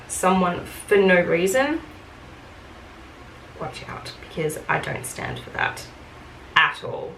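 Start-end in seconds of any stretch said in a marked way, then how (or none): 3.6-7.1 menacing whisper. Real, not acted. Warning about abusive customers.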